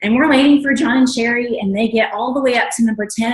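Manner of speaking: ordinary talk, nothing marked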